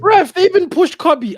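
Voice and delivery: high, loud outraged yell; indignant shout. Real, not acted. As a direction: shout the foul at the ref. Protesting a foul.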